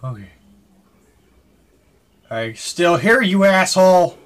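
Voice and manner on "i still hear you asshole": defiant shout at an unseen enemy; angry shout. Real, not acted. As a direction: yell it, higher and louder, slowly. Shouting at unseen monster in game.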